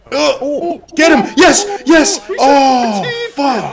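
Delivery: loud high excited yelling; cheering on a fight. Real, not acted. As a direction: yell at the screen in excitement. Watching a player's close fight.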